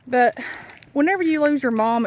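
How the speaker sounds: ordinary talk, nothing marked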